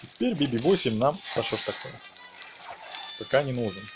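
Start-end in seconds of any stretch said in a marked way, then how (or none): none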